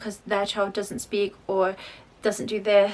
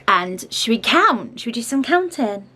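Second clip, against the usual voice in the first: louder, coaxing invitation; 3 semitones higher, 7 dB louder than usual. Real, not acted. Mum inviting her toddler to count, coaxing. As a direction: invite a little one to play along.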